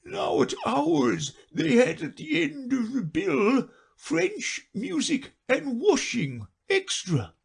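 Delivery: high, slow, proud with a little laugh; a sad creature bragging; high slow lament; Mock Turtle. Mock Turtle listing extras.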